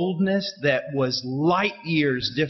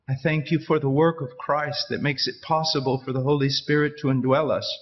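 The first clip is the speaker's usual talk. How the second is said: prayer; 5 semitones lower than usual. Real, not acted. Closing prayer, low and soft.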